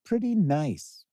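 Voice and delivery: pretty nice said slow in a put-on voice, back at normal pitch; a lazy, drawn-out echo. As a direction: drag it out slowly in a different voice. Playful repeat of own remark.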